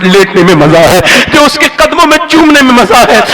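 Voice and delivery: loud, sustained sermon delivery; full-force preaching. Acted. Preacher urging listeners to honour parents, loud preaching. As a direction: preach it hard to the hall.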